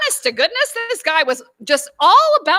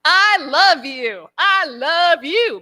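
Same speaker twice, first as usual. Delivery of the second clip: tender plea; much slower than usual. Retold or imitated. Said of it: "And I'm like".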